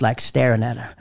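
usual speaking voice

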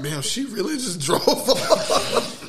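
slow, drawn out through laughter; laughing mock shock. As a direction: stretch it out, laughing at him.

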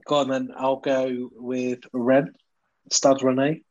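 ordinary talk

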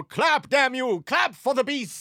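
loud, high, slow mock demand for applause; acted shouted command. Showman ordering a nervous crowd to applaud, shouted command. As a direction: belt it out like a frantic showman.

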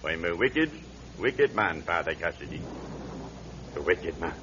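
low, soft, a touch of remorse; a hint of a guilty old man; mild remorse. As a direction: say it heavy with regret. Confessing his sins to priest.

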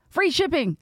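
excited ad-read emphasis on "Free shipping"; punchy repeat. Shown as a demonstration. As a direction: sell "Free shipping" like the best part. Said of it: "repeats the offer for emphasis".

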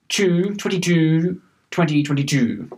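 slow, mock Australian commentator accent; hammed-up commentator accent on "twenty-two"; Richie Benaud. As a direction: draw out each "two" as "chew" in a commentator's accent. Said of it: "last time I'm pulling it out".

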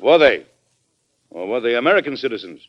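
loud high snap on the first question, then firm; pointed challenge. Acted. President challenging the secretary, pointed and loud. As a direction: press the point hard.

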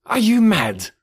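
high, loud, incredulous question; astonished cry. A narrator's reading. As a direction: cry out as if he has lost his mind. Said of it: "cried the latter".

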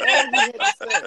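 high loud laughing end. Real, not acted. Brother insisting Daniel only had to ask, laughing. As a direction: break into laughter on it.

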